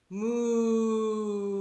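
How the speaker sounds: put-on drawn-out playful moo for the kids; mock cow lowing. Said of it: "what sound does a cow make".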